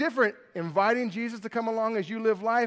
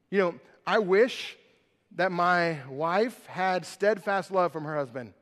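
ordinary talk, nothing marked

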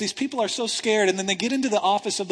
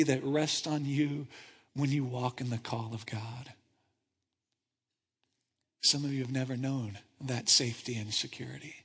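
soft altar call; 6 semitones lower, 10 dB quieter than usual. Acted. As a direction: draw them in gently. Quiet invitation to follow Jesus.

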